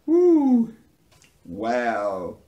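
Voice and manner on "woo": high, loud "woo"; whoop. Real, not acted. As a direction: let out an amused high whoop. Exclaiming after a laughing fit.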